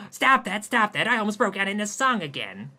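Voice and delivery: alarmed protest. Acted. Alarmed at show tunes.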